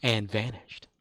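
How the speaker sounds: dramatic TV announcer recitation; show intro narration. Acted. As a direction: narrate it like an old TV opening. Recites Quantum Leap show intro.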